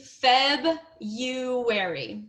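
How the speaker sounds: "February" very slowly as FEB... YOO-airy; syllable-by-syllable pronunciation. Shown as a demonstration. Teacher tells learner pretend no R, slow syllables. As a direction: stretch "February" apart, no R after the B. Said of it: "Pretend that there is no R after the B".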